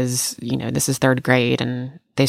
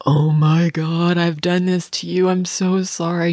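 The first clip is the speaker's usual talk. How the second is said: slow, dramatic mock apology; overplayed sorry to a friend; 4 semitones higher, slower than usual. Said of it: "I was like".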